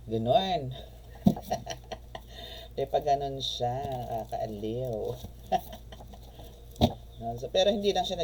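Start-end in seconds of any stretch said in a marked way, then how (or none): 0.8-2.7 long voiceless chuckle; real laugh, mostly breath; amused laugh. Real, not acted. Amused by the vision's gesture. 5.0-7.1 long breathy chuckle; real wheezing giggle; amused laugh. Real, not acted. Finds the vision entertaining.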